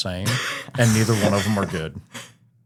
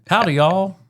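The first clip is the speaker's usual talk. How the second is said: playful Southern prompt, pitched higher; mock Southern accent; 7 semitones higher, 4 dB louder than usual. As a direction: lightly put on a Southern accent to coax. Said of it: "Can you do that Southern accent".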